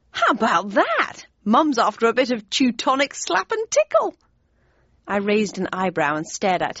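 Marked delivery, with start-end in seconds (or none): 0.1-4.1 higher, cheeky teasing brother; lightly voiced cheeky brother. A narrator's reading. Joffy teasing sister.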